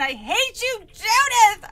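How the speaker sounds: high, full-blast scream of hatred. Acted. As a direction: scream it at the top of your lungs. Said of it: "I screamed".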